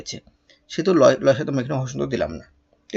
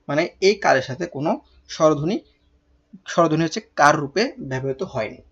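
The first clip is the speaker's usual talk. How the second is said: usual speaking voice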